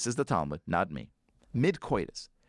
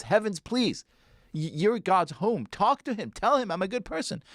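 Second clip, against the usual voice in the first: high, desperate pleading; begging the heavens; 9 semitones higher than usual. Retold or imitated. Elazar begs the heavens to vouch for him, high desperate pleading. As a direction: reach up and beg, voice climbing high. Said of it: "He looks up to the heavens and he says".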